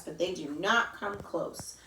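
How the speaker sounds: usual speaking voice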